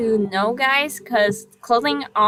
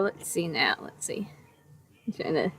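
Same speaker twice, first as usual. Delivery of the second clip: whispering; 7 semitones lower, 12 dB quieter than usual. Real, not acted. Muttered to self.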